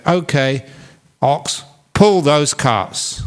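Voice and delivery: slow, deliberate command to the ox; slow firm order. Acted. Farmer slowly orders his ox to pull. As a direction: spell it out slowly to the ox.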